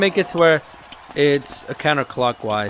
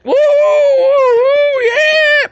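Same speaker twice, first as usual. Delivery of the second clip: mock cheering; 7 dB louder, much slower than usual. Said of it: "He's like".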